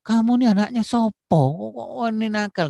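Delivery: put-on high, loud angry scolding of a small boy; scolding mother. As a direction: snap at the naughty child, shrill and loud. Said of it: "ibunya marah-marah aja terus". (ibu memarahi anak kecil dengan suara tinggi dan keras)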